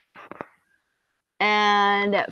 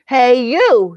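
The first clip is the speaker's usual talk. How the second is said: grouchy shout; 5 semitones higher, 13 dB louder than usual. Acted. Grouchy ladybug picks a fight.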